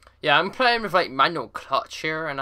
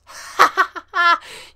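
mocking laugh; 6 dB louder than usual. Real, not acted. Mocking trapped driver.

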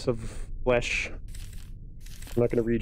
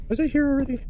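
scared streamer; 4 dB louder, faster than usual. Real, not acted. Lost in a scary level.

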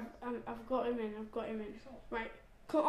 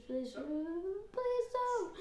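whiny pleading; 7 semitones higher, 7 dB quieter, much slower than usual. Real, not acted. Pleading over a lost cell.

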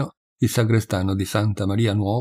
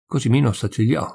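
ordinary delivery, nothing marked

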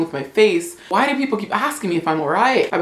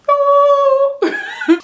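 high squealing laugh, cracking up; shrieking laugh; 6 dB louder than usual. Real, not acted. Squealing laugh at her own joke.

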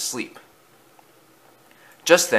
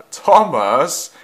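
laugh, then the name pitched up; 7 semitones higher than usual. A narrator's reading. Gordon finds Thomas terrified, laughing call. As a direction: laugh at him as you call him.

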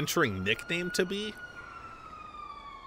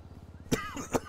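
coughing on dust; 7 dB quieter than usual. Real, not acted. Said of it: "I inhaled dust".

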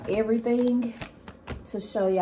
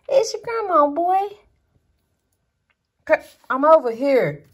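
high, loud sing-song calling of the pet; doting grandma call; 6 semitones higher, 8 dB louder than usual. Real, not acted. Doting grandma calling her pet Frank, high and loud. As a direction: coo at him loudly, way up high, to get him to come.

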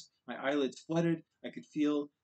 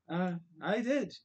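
cheerful; 6 semitones higher, slower than usual. A narrator's reading. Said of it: "I said cheerfully".

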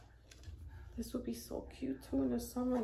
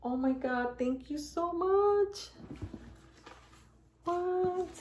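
higher and louder, slowed and gushing; overwhelmed thanks; 8 semitones higher, 3 dB louder, slower than usual. Real, not acted. Vlogger gushing thanks to viewers who sent gifts. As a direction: pour out thanks, overcome.

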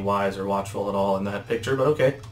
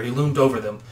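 usual speaking voice

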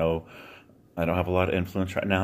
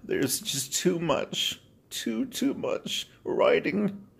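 real tears breaking through the words, voice cracking high; crying; 8 semitones higher, slower than usual. Real, not acted. Vlogger to struggling viewers, crying as he opens up. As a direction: choke the words out through tears, slow and strained. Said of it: "Sorry, I'm crying".

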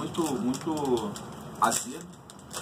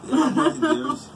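laughing exclamation; 12 semitones higher, 6 dB louder than usual. Real, not acted. Laughing at friend's comment.

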